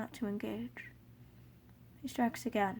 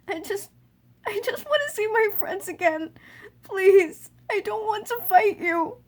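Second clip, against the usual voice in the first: sobbing plea rising to a loud high wail; from tearful begging to a crying scream; desperate wail; 10 semitones higher, 10 dB louder than usual. Acted. Child pleading with Asgore, crying louder and higher.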